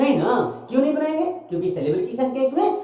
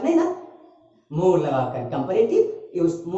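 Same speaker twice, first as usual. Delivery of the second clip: usual speaking voice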